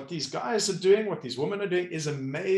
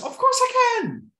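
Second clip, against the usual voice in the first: much higher, louder, delighted agreement; eager yes; 6 dB louder than usual. Retold or imitated. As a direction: jump at the chance like a yes man. Eager yes-man reply.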